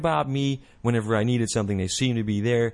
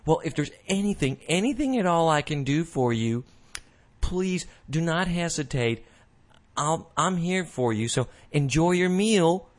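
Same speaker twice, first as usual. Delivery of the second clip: higher, sugary mock server voice, stretched out; mock overeager waitress; 7 semitones higher than usual. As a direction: ham up the overly attentive waitress. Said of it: "And then she goes like".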